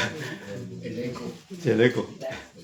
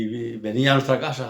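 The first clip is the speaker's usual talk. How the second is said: ordinary talk, nothing marked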